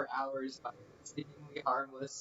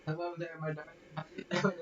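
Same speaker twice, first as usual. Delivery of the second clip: light imitation of a Cornish country accent, pitched up; mock Cornish accent; 6 semitones higher, much faster than usual. Repeating the Cornish alter's greeting back to her in a mock Cornish accent. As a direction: teasingly copy her Cornish lilt, higher. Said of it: "in a slightly different accent".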